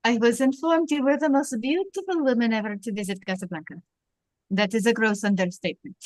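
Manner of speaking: put-on lightly French-accented police captain. Captain greets a famous visitor at his table, French accent. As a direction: play Renault with a French accent. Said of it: "I think you do the accent".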